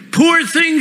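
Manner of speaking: high, pitying woman's cry; a touch of a tender woman's pity. Acted. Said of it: "she cried".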